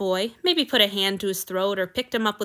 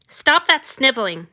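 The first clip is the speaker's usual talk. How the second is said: put-on sharp, louder snap at a crying boy; snapped; 5 semitones higher, 6 dB louder than usual. A narrator's reading. Vampire lord snapping at his weeping sacrifice, sharp and louder. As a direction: bite the words off in irritation. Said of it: "he snapped".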